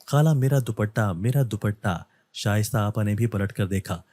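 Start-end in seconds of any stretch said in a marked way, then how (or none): none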